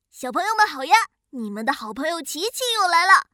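plain reading voice

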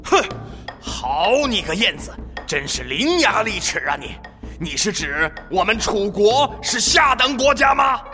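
indignant royal outburst; rising to a shout; angry king. Acted. King angered by the retort.

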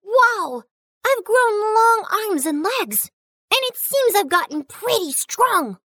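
high, delighted wonder; amazed. Acted. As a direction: marvel at your new body. Pea discovers he has grown.

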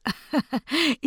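soft sly witch chuckle. Acted. Witch laughs slyly. (狡猾的轻笑)

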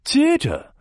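plain reading voice

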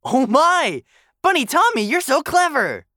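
high-pitched admiring bunny voice; delighted praise. Acted. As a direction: gush over the clever rescuer. Rabbits praise Tommy for the rescue.

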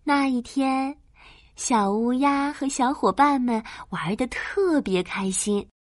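usual speaking voice

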